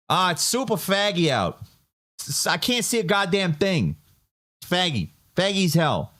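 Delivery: imitating grandma, high with a strong Boston accent; grandma's Boston accent. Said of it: "I'm doing it not great right now".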